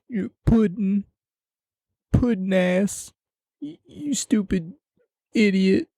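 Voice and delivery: high, put-on voice of a ranting heckler; mock angry heckler. Vlogger pretending to insult Kevin Owens, high whiny rant. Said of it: "I'm not worried about".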